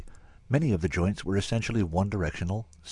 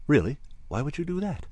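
pitched-up, intrigued questions; startled interest; 7 semitones higher than usual. A narrator's reading. Threatened alien asks the warrior why, startled interest. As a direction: ask with bright, startled curiosity. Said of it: "I radiated startled interest".

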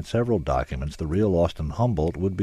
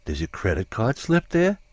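stunned disbelief; 7 semitones higher, 3 dB louder than usual. A narrator's reading. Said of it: "In stunned disbelief".

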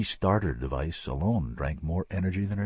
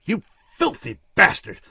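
raging; 13 semitones higher, 11 dB louder than usual. Acted. Rebecca raging at Dimsdale. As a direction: hurl it at him in rage. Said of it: "she raged".

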